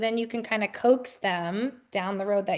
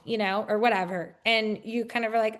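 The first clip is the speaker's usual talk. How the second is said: ordinary talk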